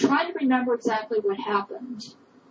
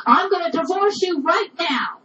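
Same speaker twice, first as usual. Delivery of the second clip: angry threat; 7 semitones higher, 3 dB louder than usual. Retold or imitated. Wife threatens husband over boy.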